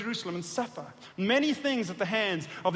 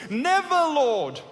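slow, loud, high protest; rebuking cry; 5 semitones higher, 6 dB louder, much slower than usual. Acted. Peter rebuking Jesus, slow loud protest. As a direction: drag it out as a rebuke. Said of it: "Began to rebuke Jesus".

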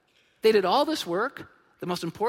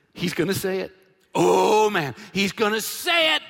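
excited, rising to a louder burst; eager anticipation; 5 dB louder than usual. Pretend. Friends lowering the man, excitedly expecting the healing words. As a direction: bubble over with hope.

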